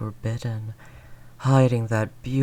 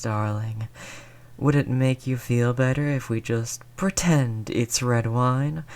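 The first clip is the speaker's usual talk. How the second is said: ordinary talk, nothing marked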